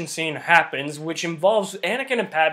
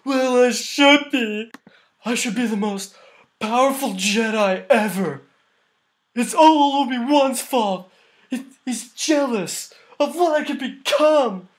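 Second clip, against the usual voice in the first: drawn-out, higher-pitched whiny mockery of bad acting; mocking Hayden Christensen; 5 semitones higher, 4 dB louder, slower than usual. Reviewer mimicking Anakin boasting to Padme, hammed-up terrible acting. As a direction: overact it slowly, swelling on key words. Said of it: "this part has terrible acting by Hayden Christensen".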